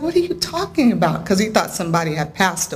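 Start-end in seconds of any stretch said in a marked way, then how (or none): 0.0-1.2 high, quick puzzled question; retold bafflement. Husband questions his crying wife, retold bafflement. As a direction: imitate someone baffled and concerned, pitched up.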